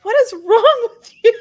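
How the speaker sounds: high, loud laughing outburst; laughing disbelief. Real, not acted. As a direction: burst out laughing as you ask. Laughing at friend's joke.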